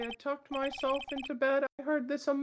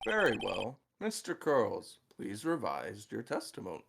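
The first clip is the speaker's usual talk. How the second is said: deep judge voice; 3 dB quieter than usual. Acted. Judge voice, very low.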